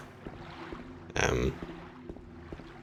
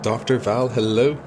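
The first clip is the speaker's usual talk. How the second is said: bright, louder and higher welcome; excited greeting; 6 semitones higher, 4 dB louder, slower than usual. Real, not acted. Streamer welcoming raider Doctor Val, excited and loud. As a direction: greet her like a friend arriving.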